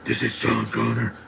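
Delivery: mock gruff superhero voice; Batman. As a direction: put on a rough, exaggerated hero growl. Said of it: "You're doing your Batman voice".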